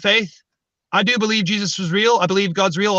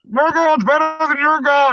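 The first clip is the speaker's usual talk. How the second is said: high, blaring bullhorn voice; imitated bullhorn; 6 semitones higher than usual. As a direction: mimic someone preaching through a bullhorn. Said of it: "When you're using a bullhorn".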